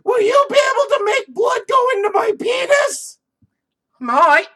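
shrieking high mock voice; overacted squealing lover; hammed-up squeal. Mock sex scene voice.

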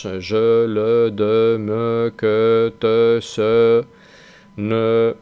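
"je, le, de, me, que, te, se, ne" each ending in a rounded schwa; French monosyllables. Shown as a demonstration. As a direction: list the words and stress the final E sound. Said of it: "Veis que estoy diciendo E". (cada "E" final con schwa francesa)

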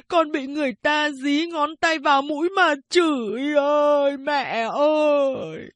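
slow, high, crying wail to his mother; wailing lament. A narrator's reading. Kim Đồng in the reeds wails to his mother, slow high sobbing lament. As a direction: sob and drag out every word like a keening woman. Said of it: "vừa khóc vừa kể lể như đàn bà".

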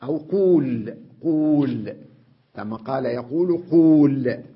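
قول hit loud and held; loud emphatic قول as the form to avoid; stressed wrong form. Shown as a demonstration. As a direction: punch the word قول to show the wrong form. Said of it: "بدل ما أقول قال".

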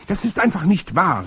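high, loud protesting cry; cried out. A narrator's reading. Boy cries out against his grandmother's claim. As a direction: call it out in protest. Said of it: "rief ich".

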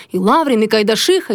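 ordinary delivery, nothing marked

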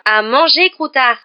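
loud, high, furious interruption; furious outburst. A narrator's reading. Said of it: "acheva Ron d'un ton furieux".

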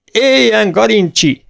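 put-on the young men roar the name together; booming cheer. A narrator's reading. Young Ferencváros fans cheer Garancsi with a booming shout. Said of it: "Dörgő hangon kiáltották".

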